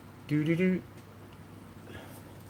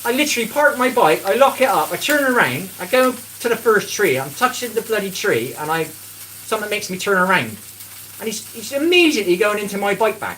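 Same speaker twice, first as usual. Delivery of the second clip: louder, higher, heated storytelling; worked-up retelling; 7 semitones higher, 6 dB louder than usual. Retold or imitated. Telling viewers about the bike thief, loud and heated. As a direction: tell it like it still winds you up.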